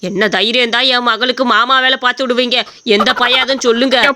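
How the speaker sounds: put-on old father scolding the contest host; angry old father. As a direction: growl at them like an outraged old father.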